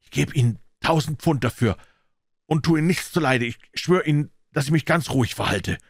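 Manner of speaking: anxious begging promise; pleading tone. A narrator's reading. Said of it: "bat Jennings in flehendem Ton".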